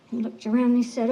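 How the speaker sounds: usual speaking voice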